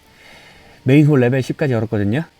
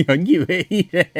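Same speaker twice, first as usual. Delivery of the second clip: laughing; 7 semitones higher, slower than usual. Real, not acted. Laughing at the game's voice acting.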